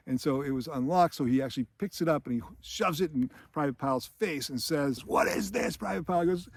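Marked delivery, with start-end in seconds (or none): 5.0-5.7 higher-pitched imitation of an outraged sergeant; mock indignant demand. Sergeant shoves lock in recruit's face, mock indignant demand. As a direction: play the accusing sergeant without real volume. Retold sergeant confronting Pyle.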